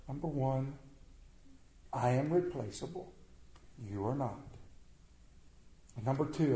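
soft, tender vow from a wounded man; tender whisper-soft. Retold or imitated. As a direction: speak low and gently, like a husband who may die.

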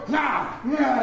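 short playful battle cry; mock charge yell. Boy charges at the tree with a mild mock yell. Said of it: "And the boy".

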